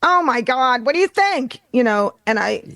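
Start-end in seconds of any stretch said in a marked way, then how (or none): 0.0-1.6 high, animated New York accent. Shown as a demonstration. Demonstrating her New York voice to friends, animated New York accent. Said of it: "I get kind of a".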